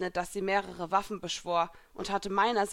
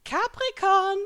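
high, loud cheeky summons; playful call; 13 semitones higher, 8 dB louder, slower than usual. A narrator's reading. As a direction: call it out with a wink. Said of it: "rief ich augenzwinkernd".

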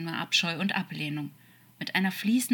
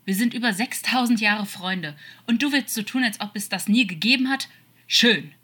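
louder, higher exasperated outburst; indignant, wounded raised voice; hurt, angry protest; 6 semitones higher, 8 dB louder than usual. Acted. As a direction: protest loudly at being disowned. Demon quarrels over the denied friendship.

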